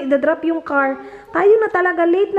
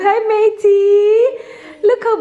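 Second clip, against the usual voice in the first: very high, slow, louder squeal of delight; 8 semitones higher, 4 dB louder, much slower than usual. Real, not acted. Mother squealing hello to her baby.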